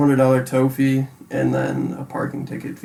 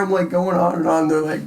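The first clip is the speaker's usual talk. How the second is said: normal talking voice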